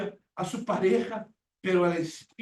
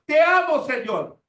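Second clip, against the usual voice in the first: loud, high burst of empty praise; mock fervent cry; 6 semitones higher, 9 dB louder than usual. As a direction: parody lip-service love. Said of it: "Es fácil, de boca".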